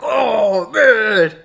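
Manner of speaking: put-on loud, drawn-out kid groan; mock dismay. Boys are given a timeout, hammed-up groaning. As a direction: whine it like a punished schoolboy.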